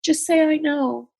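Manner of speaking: mother's gentle advice. Retold or imitated. Dying mom tells daughter, a light imitation. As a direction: lightly voice mom telling her to just listen. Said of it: "she just said".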